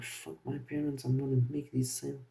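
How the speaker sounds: a quiet fierce vow under the breath; hushed determined thought. Retold or imitated. To himself mid sales call, quietly defiant about his parents. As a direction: mutter it to yourself, fast and resolved.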